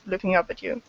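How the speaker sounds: ordinary talk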